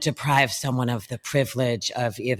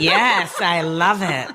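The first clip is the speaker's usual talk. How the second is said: higher, louder, thrilled; delighted; 5 semitones higher, 7 dB louder, slower than usual. Real, not acted. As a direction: cheer it with delight. Delighted at the rolled r.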